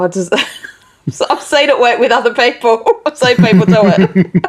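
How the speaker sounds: laughing through the words, embarrassed. Real, not acted. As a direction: giggle while answering. Laughing while answering duet offer.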